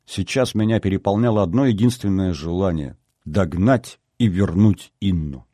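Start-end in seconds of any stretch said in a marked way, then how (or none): none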